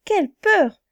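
high, loud gasp of fright; frightened exclamation. A narrator's reading. Narrator voicing Goldilocks' fright on waking, high loud exclamation. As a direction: burst out in alarm.

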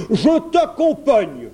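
loud, high, drawn-out bear shout; crying out. A narrator's reading. Bear decides to join the prince, crying out. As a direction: burst out eagerly as the bear. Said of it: "s'écria l'ours".

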